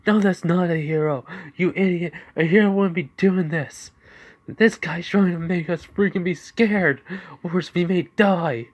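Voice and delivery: high, loud frightened protest; panicked henchman. Acted. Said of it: "He goes".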